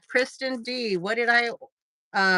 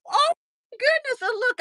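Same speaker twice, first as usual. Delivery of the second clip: excited; 14 semitones higher, 3 dB louder than usual. Real, not acted. Discovering reply feature.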